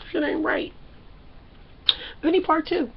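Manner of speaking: ordinary talk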